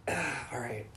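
soft sighing surrender; resigned sighing. Retold or imitated. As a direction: sigh and give in reluctantly.

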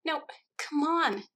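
high, drawn-out pleading; slow, high-voiced insisting; insistent. A narrator's reading. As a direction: press a reluctant friend, pitch way up. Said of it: "Alice insisted".

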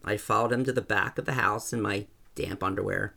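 ordinary delivery

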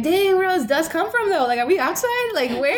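high, bewildered whining at the dust; mock bafflement. Sweeping the porch, baffled at dust, high mock disbelief. Retold exasperated reaction to dust.

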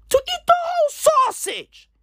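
hammed-up falsetto repeat of the joke; mock squeaky voice. Comic repeating his crude joke in a squeaky falsetto. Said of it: "repeated in a put-on voice".

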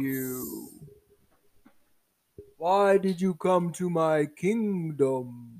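slow, stretched-out kingly question; giant turtle king. Acted. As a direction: draw it out like a ponderous old turtle ruler. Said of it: "the giant turtle king".

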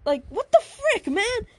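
high, loud startled outburst; scared. Acted. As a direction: act out a startled, indignant yelp. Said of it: "Naomi got scared".

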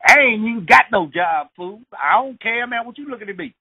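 high, pushy street tough calling out; high-pitched tough hassling a stranger. Retold or imitated. Street tough picking a fight.

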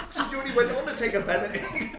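high-pitched words through laughter; laughing. Real, not acted. Teasing the storyteller about the penalty, laughing. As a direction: squeeze the line out while laughing.

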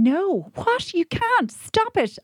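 very high, frantic pleading; panicked. Retold or imitated. Woman at a rescue centre pleads for a dog, high frantic plea. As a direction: beg them to stop it. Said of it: "And I was going".

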